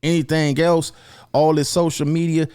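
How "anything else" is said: ordinary talk, nothing marked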